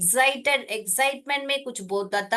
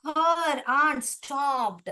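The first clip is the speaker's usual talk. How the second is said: story reading; 4 semitones higher, much slower than usual. A narrator's reading.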